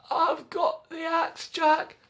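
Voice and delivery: high, slow proud mum; high, slow cheerful mum; Mum's voice. Acted. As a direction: announce it like a pleased mum. Mother returns again.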